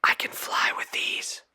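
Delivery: secretive whisper. A narrator's reading. Girl whispering a secret to the trolls, hushed. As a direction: tell it like a big secret. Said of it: "I whispered".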